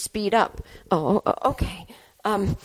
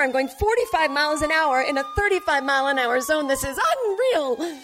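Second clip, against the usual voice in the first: higher, panicked rambling; panicky; 7 semitones higher than usual. Acted. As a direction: fret as the cops chase. Panicked police chase.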